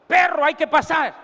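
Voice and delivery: bossy order. Acted. Servant orders dog forward.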